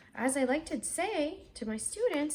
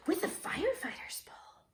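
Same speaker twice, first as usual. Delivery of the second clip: whisper; 7 semitones higher, 5 dB quieter than usual. Acted. Child character whispering idea.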